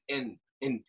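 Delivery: ordinary talk, nothing marked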